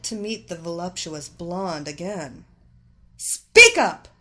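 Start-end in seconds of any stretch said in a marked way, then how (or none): 3.2-4.1 high, very loud angry shout; explosive, spitting demand; spat out. A narrator's reading. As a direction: shout it impatiently down the line. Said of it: "she spat out".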